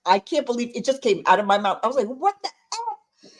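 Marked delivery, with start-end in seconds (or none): 2.1-3.3 high, drawn-out mock disbelief, half laughing; retold stunned expletive. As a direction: stretch out the exclamation, high and slow. Re-enacts her outburst after the crash.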